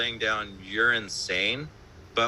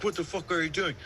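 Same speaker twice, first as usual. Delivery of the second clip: David Bennett; 6 semitones higher than usual. Retold or imitated. Producer confronting guitarist for playing between takes, mock-indignant high voice. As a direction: imitate an outraged producer in a raised pitch. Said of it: "But David Bennett ... grabs the guitar".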